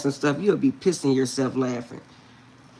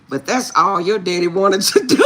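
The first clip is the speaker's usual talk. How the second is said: ordinary delivery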